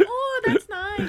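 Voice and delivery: high, slow auntie voice; sweet, slow auntie reply; Filipino auntie. Retold or imitated. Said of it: "and she's like".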